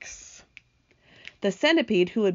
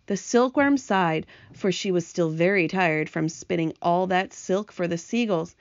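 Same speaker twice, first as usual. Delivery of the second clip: usual speaking voice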